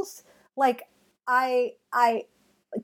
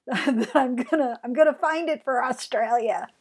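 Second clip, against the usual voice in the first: ordinary delivery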